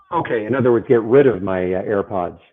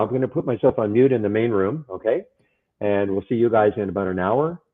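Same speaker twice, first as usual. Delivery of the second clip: ordinary delivery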